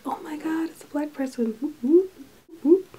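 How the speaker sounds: high-pitched mock squeal of delight; excited re-enactment. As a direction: act out an excited discovery, ending in high whoops. Retells excitement at rare figure.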